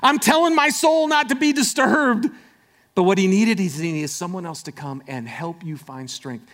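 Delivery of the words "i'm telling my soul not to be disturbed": high, loud and frantic; preacher playing a panicked David pleading. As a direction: cry out like a man freaking out. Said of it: "this is David who's like".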